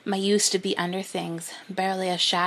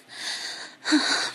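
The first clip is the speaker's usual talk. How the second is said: breathy sigh. Acted. Erotic scene, breathy sigh.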